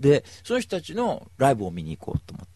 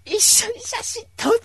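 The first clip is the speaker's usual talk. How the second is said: high squealing plea for a photo; excited fan request; 7 dB louder than usual. Retold or imitated. Fan at the wedding squealing for a photo with him. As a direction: beg like a thrilled fan.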